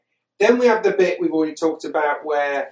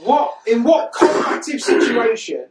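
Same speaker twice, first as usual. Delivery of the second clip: high, exasperated questioning; baffled comic rant; incredulous; 7 semitones higher, 4 dB louder than usual. Real, not acted. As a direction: demand an answer in sheer disbelief. Incredulous at the aliens' plan.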